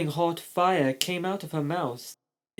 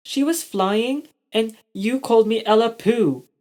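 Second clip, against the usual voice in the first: high, louder complaint; indignant shout; 7 semitones higher, 4 dB louder than usual. A narrator's reading. Ella shouting at Robbie about the name, indignant. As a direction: protest at the name-calling. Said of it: "Ella shouted".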